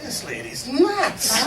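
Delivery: slow, high-pitched mock disbelief; comic disbelief. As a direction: draw it out as an amused verdict. Retold disbelief at bossy colleague.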